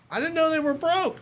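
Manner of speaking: exaggerated retold self-defense; mock protest. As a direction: protest your innocence way up high. Retells defending himself to doctor.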